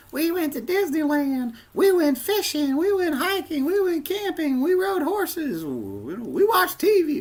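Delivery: put-on high, childish sing-song recital; kids reciting. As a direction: chirp each trip like an eager schoolkid. Voicing classmates reciting summer trips.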